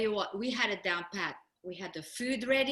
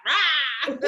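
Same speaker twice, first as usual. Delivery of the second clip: louder laughing rah; playful roar; 4 semitones higher, 7 dB louder than usual. Retold or imitated. Dancers greeting Tony's arrival, laughing roar. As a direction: roar it out with a grin. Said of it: "we were like".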